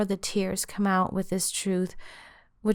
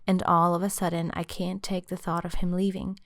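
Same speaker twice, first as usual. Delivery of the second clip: ordinary delivery, nothing marked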